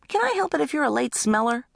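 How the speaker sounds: put-on high-pitched little-kid retort; defensive little brother. Little brother answering teenager, high defensive retort. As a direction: answer back like a pestered kid. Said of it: "Little brother".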